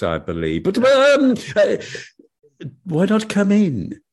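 high coy temptress voice; flirty devil's wife. Acted. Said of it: "she said".

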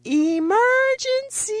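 slow, high, loud yell. Retold or imitated. Bleeding mother yelling back to her husband, slow loud shout. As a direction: shout each syllable for help. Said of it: "So I just yelled back".

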